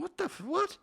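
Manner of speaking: high, startled disbelief; a stranger asked for his seat, baffled. Retold or imitated. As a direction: squeak it out in shock. Bystander's baffled reply.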